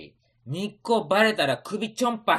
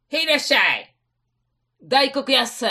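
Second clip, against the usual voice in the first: irasshaimase greeting; 8 semitones higher, 6 dB louder than usual. Acted. Shopkeeper greets customers.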